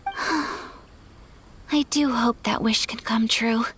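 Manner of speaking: slow, breathy sigh into a hushed hope; wistful whisper. Acted. Ayaka sighing her hope to the traveler, slow whisper.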